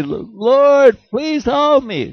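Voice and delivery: high, loud, drawn-out cry for help; mock desperate plea. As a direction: beg God like a panicked caller, hammed up. Caller begging God for help.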